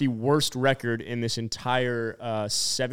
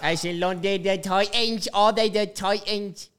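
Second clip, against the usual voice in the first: higher, garbled mock British accent; botched British accent; 5 semitones higher, slower than usual. Host clowning about the London game in a mangled British accent. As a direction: slur it like a British accent gone badly wrong. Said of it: "a little bit of a stroke mixed with my British accent".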